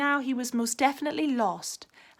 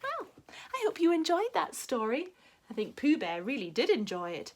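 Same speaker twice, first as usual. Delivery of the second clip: ordinary delivery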